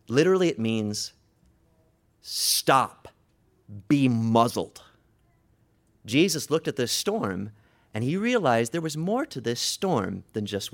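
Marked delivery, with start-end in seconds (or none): 2.2-4.8 firm, forceful command; bellowed rebuke. Acted. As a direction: order the storm to shut up. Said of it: "he bellows out".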